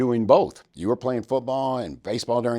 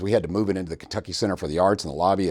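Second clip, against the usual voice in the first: ordinary talk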